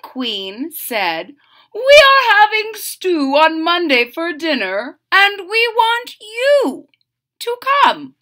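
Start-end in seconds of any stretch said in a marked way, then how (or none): 1.7-8.1 royal invitation. Acted. King and queen invite.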